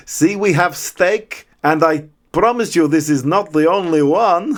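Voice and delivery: slow, hammed-up Russian accent sliding toward Dracula; comedy Russian accent. Retelling Griffiths' Russian character offering steak, slow mock Russian accent. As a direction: stretch the words in a mock Russian like a cartoon count. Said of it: "that was a bit Dracula".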